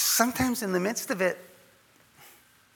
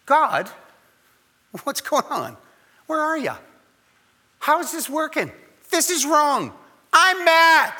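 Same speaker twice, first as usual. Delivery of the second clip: rising, louder frustrated cry to God; higher and louder, mad at God; angry complaint to God; 9 semitones higher, 6 dB louder than usual. Acted. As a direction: let your anger at God build to a shout.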